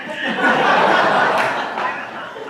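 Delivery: loud, high, breathy laugh; burst of laughter. Real, not acted. Speaker laughs at her own joke about the men, loud laugh. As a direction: let a big laugh burst out.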